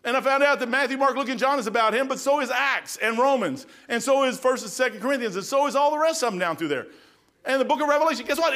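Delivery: high, loud preaching to the congregation; full-force preaching. Acted. Preacher telling the congregation who the Bible is about, in full-force preaching. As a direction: preach it out to the whole room.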